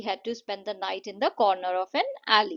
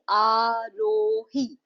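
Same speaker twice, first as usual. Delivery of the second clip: Aarohi in three long, separate beats, आ, रो, ही; syllable by syllable; 5 dB louder, much slower than usual. Shown as a demonstration. Teacher sounding out a classmate's name, syllable by syllable. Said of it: "आरोही में तीन syllable का sound है".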